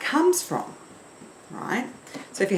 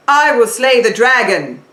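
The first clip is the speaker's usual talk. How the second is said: loud, forward, higher knight declaration; knight voice in the face; 4 semitones higher, 8 dB louder than usual. Shown as a demonstration. Teacher demos a knight's forward voice, loud and bold. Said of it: "quite forward and it's in the face".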